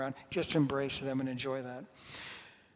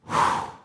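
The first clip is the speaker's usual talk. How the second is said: audible breath; 8 dB louder than usual. Shown as a demonstration. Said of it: "world with breathing".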